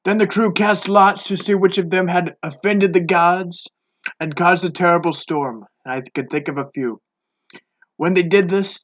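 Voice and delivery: normal talking voice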